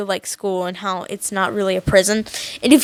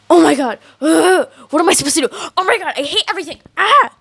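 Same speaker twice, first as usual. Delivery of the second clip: fast, rising higher into a panicked shriek; frantic panic; 5 semitones higher, faster than usual. Retold or imitated. English teacher panicking over what to do, fast frantic shriek. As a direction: spiral into a frenzy and end on a cry. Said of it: "silently panic".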